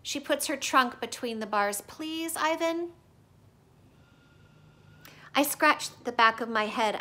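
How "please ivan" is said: pleading. Acted. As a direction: ask sweetly like a small child. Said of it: "Ruby pleads".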